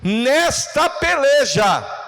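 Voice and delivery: loud, high, slowly stretched battle cry; brado de pregador. Shown as a demonstration. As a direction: demonstrate a slow, forceful shout for the crowd. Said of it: "faz assim, ó". (brado alto e arrastado)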